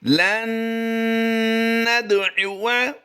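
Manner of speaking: लन नदउवा chanted slowly and high with nasal ghunna on न; Quranic recitation. Shown as a demonstration. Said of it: "आइए इसकी अदायगी करते हैं".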